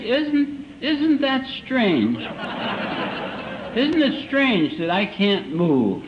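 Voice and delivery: high, drawn-out puzzlement; acted slow-motion wonder; drunken pondering. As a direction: muse very slowly, like a baffled drunk. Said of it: "I lay there and think".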